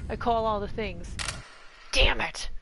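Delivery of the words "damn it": loud, punchy "damn it"; loud frustrated curse. Real, not acted. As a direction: smack the curse out. Called it, mock frustration.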